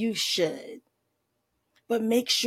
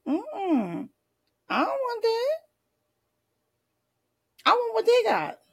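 much higher, snippy mock refusal; ungrateful refusal; 13 semitones higher than usual. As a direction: turn it down with a high mock sniff.

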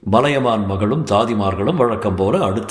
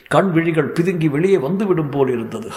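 ordinary delivery, nothing marked

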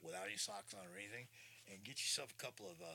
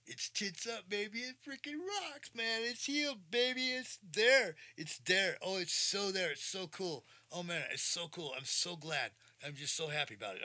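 much higher, louder, overjoyed; ecstatic; 10 semitones higher, 11 dB louder than usual. Real, not acted. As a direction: shout for joy about it. Joyful news about healed ankle.

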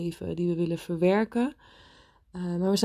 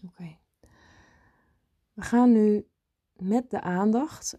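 guided meditation. Acted. Leads a meditation.

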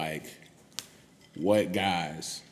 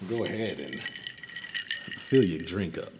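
sultry voice; much slower than usual. Acted. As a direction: croon it like a late-night DJ. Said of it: "This is this sultry voice".

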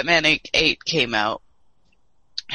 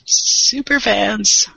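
slow, higher mocking drawl; sarcastic drawl; 5 semitones higher, 4 dB louder, much slower than usual. Real, not acted. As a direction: stretch it out sarcastically. Mocking special edition owners.

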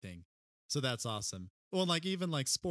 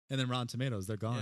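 ordinary talk, nothing marked

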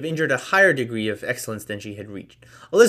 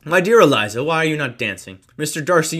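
loud, higher gallant call across the room; 5 semitones higher, 8 dB louder than usual. Acted. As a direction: call out to Eliza over the dancers. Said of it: "called out to her".

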